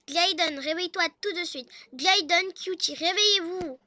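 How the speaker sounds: bossy wife rousing her husband; calling to wake someone. Acted. (appel fort pour réveiller son mari)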